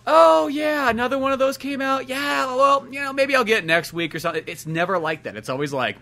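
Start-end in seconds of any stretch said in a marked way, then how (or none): 0.1-4.4 high, breezy shrug with a loud start; mock casual. Fan pretending not to care, breezy mock casual. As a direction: toss it off lightly.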